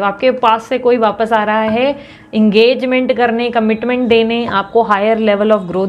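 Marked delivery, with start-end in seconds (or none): none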